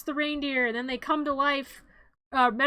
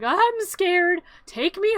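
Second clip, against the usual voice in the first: higher, slower, mock frightened plea; scared rabbit; 5 semitones higher, 6 dB louder, much slower than usual. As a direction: whimper it like a timid little rabbit. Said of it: "the rabbit, which is me temporarily, is like".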